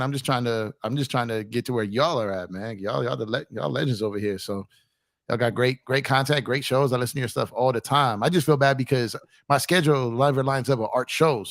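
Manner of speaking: ordinary talk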